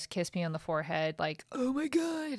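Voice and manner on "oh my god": high, breathy "Oh my God"; mock gush. Mocking her reaction to Ryan's forehead kiss, high gush. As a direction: parody a swooning reaction.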